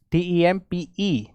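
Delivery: letters spelt slowly one by one; slow spelling out. Shown as a demonstration. Teacher spelling a word slowly for students. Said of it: "P E होना चाहिए".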